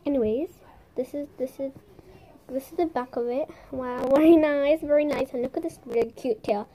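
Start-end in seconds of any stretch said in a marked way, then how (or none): none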